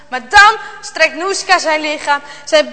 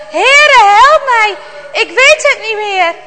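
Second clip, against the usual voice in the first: desperate cry; 5 semitones higher, 6 dB louder than usual. A narrator's reading. Jarno cries to the Lord for help, desperate yell. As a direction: cry out to God in despair. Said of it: "In zijn wanhoop gilt hij het uit".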